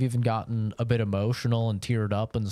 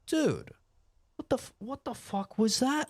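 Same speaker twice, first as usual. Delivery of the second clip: high, startled, incredulous; like you just got jumpscared by a friend; stunned disbelief; 12 semitones higher than usual. Retold or imitated. Streamer's friends react to a sudden scream, high and startled.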